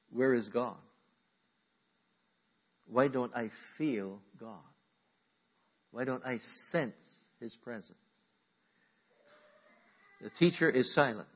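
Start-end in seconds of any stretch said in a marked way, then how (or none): 0.1-8.0 soft, fading voiced doubt; quiet questioning. Preacher voicing listeners asking where God is, softly. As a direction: ask it softly, almost to yourself. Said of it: "number one question that he's being asked".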